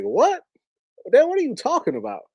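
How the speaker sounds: high, startled "what?" as if the question is absurd; surprised disbelief. Retold or imitated. Son to his dad, asked if he's mad, high surprised disbelief. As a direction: caught off guard, pitch jumping up.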